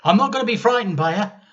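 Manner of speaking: put-on defiant brave little boy; boy's brave boast. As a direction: puff up a scared boy's courage. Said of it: "he said to himself".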